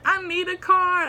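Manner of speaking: tantrum. Retold or imitated. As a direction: whine it like a toddler. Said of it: "a temper tantrum".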